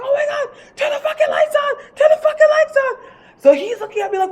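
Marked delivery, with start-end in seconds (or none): 0.0-3.0 very high, frantic squeal; panicked mock screaming. Storyteller begging her boy for lights, screaming in panic. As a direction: squeal the plea over and over, acting panicked. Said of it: "And I'm screaming".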